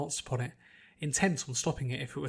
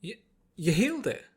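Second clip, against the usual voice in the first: amazed stammer; 5 semitones higher, 4 dB louder than usual. A narrator's reading. Applejack amazed.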